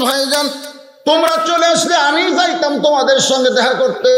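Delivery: high-pitched, ringing sermon delivery; fervent storytelling preacher, voice pitched high; impassioned preaching. Acted. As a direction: preach it in a high, intense chant.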